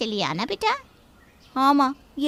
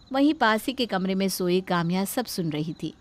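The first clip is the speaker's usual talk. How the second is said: ordinary delivery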